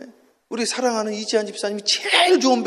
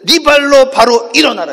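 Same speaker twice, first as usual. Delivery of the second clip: forceful, louder preacher's proclamation; loud scripture quote; 2 semitones higher, 9 dB louder than usual. Shown as a demonstration. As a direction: read it out as a big command. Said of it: "큰 소리로 해".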